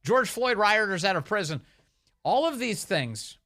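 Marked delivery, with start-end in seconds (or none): 0.0-1.6 high, insistent mock campaign pledges; Kamala Harris. Commentator listing a candidate's old positions, mock insistent. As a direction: rattle off demands, pitched well up, mimicking. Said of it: "she came out with all these posi".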